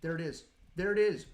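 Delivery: building from eager to shouting; excited shout. Real, not acted. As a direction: yell it out as the find appears. Finds the skull, excited shout.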